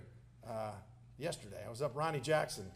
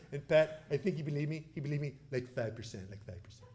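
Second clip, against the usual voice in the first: Donald Trump; 3 semitones lower than usual. Acted. President confiding in the congressman at dinner, impersonated. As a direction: confide it as the former president, trailing down. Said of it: "I'll do it in his voice".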